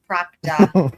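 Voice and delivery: Proctor with a lightly Boston-style r; a hint of Boston on Proctor; Boston accent. Shown as a demonstration. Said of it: "Me neither".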